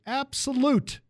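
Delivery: Absolute! barked high and loud; Absolute! hammered home; emphatic shout. Acted. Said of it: "must be absolute".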